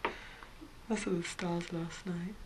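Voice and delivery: slow, hushed recollection; soft whisper. Acted. Remembering lying in a lover's arms, softly whispered. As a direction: breathe the words gently.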